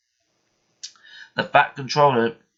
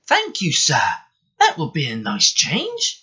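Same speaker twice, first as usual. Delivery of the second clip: light, happy voice, a little higher; happily; 4 semitones higher than usual. Acted. Edward thanks the Fat Controller, lightly happy. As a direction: cheerfully accept the new job. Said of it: "said Edward happily".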